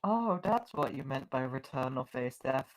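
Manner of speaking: normal talking voice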